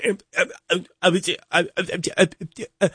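string of halting, broken-off ums; stammering ums. Acted. Interviewer who can't get a question out, mock stammering. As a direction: act stuck, unable to get past um. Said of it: "I couldn't even get a question out".

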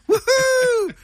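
a high, laughing whoop of joy; excited whoop. Real, not acted. Guitarist remembering the festival, whooping with delight. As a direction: cheer it out, high and bright with a laugh.

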